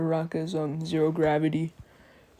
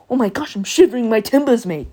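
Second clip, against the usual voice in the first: high, loud, hammed-up outcry; mock alarm; 5 semitones higher, 7 dB louder than usual. Rival shocked by a copied move, hammed-up alarm. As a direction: exclaim it like a panicky cartoon sailor. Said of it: "you know, that type of".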